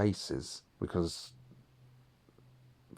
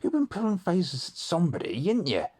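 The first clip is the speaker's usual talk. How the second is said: pitched-up mock accusation; accusing dad; 11 semitones higher, 3 dB louder than usual. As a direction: imitate dad not buying it. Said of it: "And he's like".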